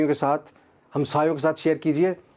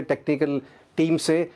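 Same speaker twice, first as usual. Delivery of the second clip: usual speaking voice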